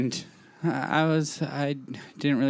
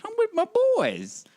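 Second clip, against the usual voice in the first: much higher, sing-song; mock bro excuse. Excusing himself to his angry friend, high sing-song. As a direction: whine it playfully. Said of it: "I'm like".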